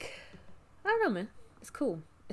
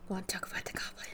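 quick teasing whisper; 13 dB quieter, faster than usual. Real, not acted. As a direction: lean in and whisper it fast. Teasing aside to co-host.

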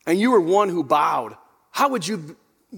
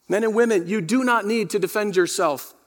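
ordinary talk, nothing marked

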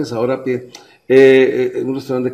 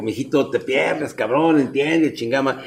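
low, hushed warning through the teeth; hushed dad warning; 3 semitones lower, 7 dB quieter, slower than usual. Retold or imitated. Father quietly warning his son in public, hushed. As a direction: keep it down so people don't hear.

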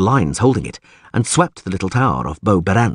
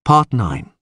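usual speaking voice

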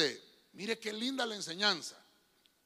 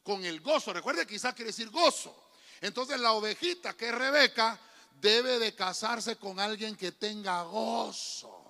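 ordinary talk, nothing marked

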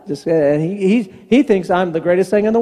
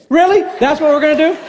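higher, louder mock incredulous dad; mock disbelief; 7 semitones higher, 4 dB louder, faster than usual. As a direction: play up the disbelief. Dad's incredulous reply to son.

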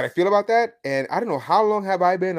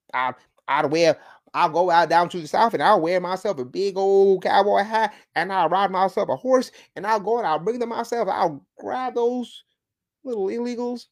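hammed-up higher-pitched cowboy voice; 3 semitones higher than usual. Host playing cowboy heading South to round up illegals, mock cowboy. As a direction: play at being a cowboy rounding people up. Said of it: "this is my cowboy voice".

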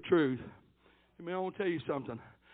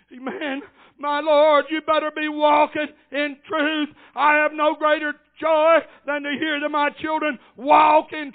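raised, driving pulpit voice building to a long shout; full-force preaching; 7 semitones higher, 8 dB louder than usual. Real, not acted. Old preacher telling his church what makes him happy, full-force preaching. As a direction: hammer the key words, stretching the last.

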